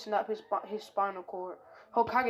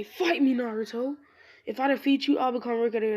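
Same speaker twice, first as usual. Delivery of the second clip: raised, louder challenge to a rival; shouted challenge; 3 semitones higher, 5 dB louder than usual. Acted. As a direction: call him out to fight, pitched up. Kiba jumps out challenging Naruto.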